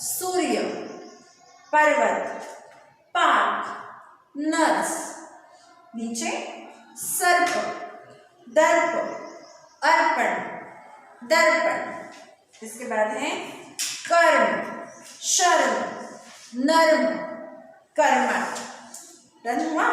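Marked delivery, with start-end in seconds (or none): 0.0-4.9 words called out louder for repeating; loud model reading. Shown as a demonstration. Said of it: "एक बार और reading करते हैं". 7.0-11.9 loud model reading. Shown as a demonstration. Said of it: "एक बार और reading करते हैं". 14.1-18.6 loud model reading. Shown as a demonstration. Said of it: "एक बार और रीडिंग करते हैं".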